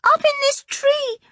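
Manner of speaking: put-on high little girl's voice, steadier now; stronger voice. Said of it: "in a stronger voice".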